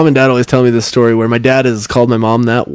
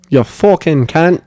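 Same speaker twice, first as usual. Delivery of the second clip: put-on slow Australian accent; 2 semitones higher, slower than usual. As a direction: stretch the insult slowly, mock Aussie style. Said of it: "How do you say it in Australian accent?".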